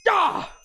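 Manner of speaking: a sharp high yelp of shock; high startled cry at the bad news; dad's sudden shriek. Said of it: "my dad was like".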